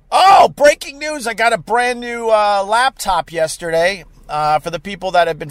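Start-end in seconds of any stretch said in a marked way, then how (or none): 0.1-1.3 high, loud, drawn-out burst; sudden excited interjection; excited announcement. Real, not acted. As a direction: blurt out big news, pitched up and slow. Suddenly announces his news.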